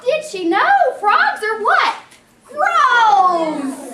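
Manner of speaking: high, loud question and shouted answer; hammed-up loud outburst of disgust; rousing call-out. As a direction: whip up the kids with a loud, high cry. Rousing children to shout gross.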